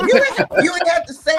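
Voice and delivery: laughing. Real, not acted. Cohost teasing guest, laughing through the words. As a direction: tease while still cracking up.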